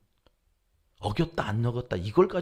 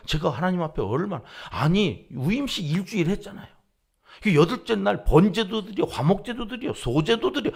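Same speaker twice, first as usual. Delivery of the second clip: loud, high pleading protest to God; heated protest; 4 semitones higher, 4 dB louder than usual. Retold or imitated. Preacher retelling his complaint to God, raised pleading voice. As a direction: argue with God, voice rising.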